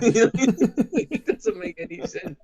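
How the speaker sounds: slow words through laughter; laughing. Real, not acted. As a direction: laugh it out, can't stop. Laughing at the film's effects.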